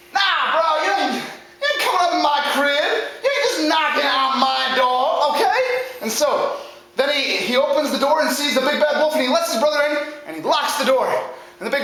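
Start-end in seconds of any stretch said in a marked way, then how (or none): 0.1-5.6 high-pitched bro refusal; slangy, put-out little pig; indignant second pig. Acted. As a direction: turn him away like a sassy dude.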